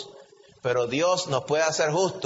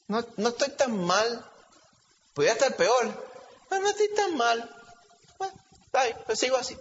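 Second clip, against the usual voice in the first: smug inner voice; 8 semitones higher than usual. Retold or imitated. Preacher mimics a believer excusing himself, in a lightly smug voice. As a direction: talk yourself into feeling fine. Preacher voicing complacent self-talk.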